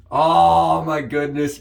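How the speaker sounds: ordinary talk, nothing marked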